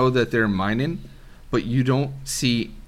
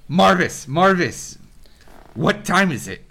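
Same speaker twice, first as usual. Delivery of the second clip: higher, louder dwarf call to his brother; dwarf cueing his brother for a break; 8 semitones higher, 4 dB louder than usual. Acted. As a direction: call out playfully to Marvis.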